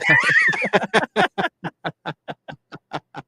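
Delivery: long burst of high-pitched laughter; big high laugh. Real, not acted. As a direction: let a real laugh ring out high. Reaction to listener's joke.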